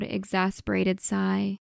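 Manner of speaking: ordinary delivery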